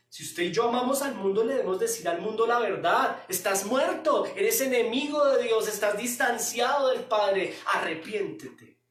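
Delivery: loud, driving sermon delivery; fiery preaching. Acted. Pastor preaching at full force to the church. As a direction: preach it hard to the congregation.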